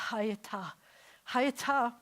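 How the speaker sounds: Hayata with an airy breathed h; breathy hayata. Shown as a demonstration. Teacher showing how hayata empties the breath. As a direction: let all the wind out on Hayata. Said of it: "You lose all of your wind".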